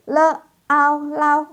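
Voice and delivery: le, au, lau spelled out high and loud; spelling demo. Shown as a demonstration. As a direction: build lau from its sounds. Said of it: "ini lo kasih contoh".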